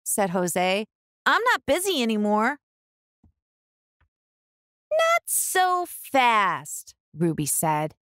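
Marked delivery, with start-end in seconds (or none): 1.2-2.6 put-on fast, eager boy; boy blurting out in a rush; hurried excuse. Jose rushes in. 4.9-6.9 slow, drawn-out not so fast; Ruby halting her brothers, slow and high; stern stop. Acted. Ruby stops the boys.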